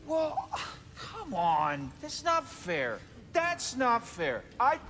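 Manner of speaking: high, whiny complaint; indignant protest. Acted. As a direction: protest like a cheated child. Protesting that it is unfair.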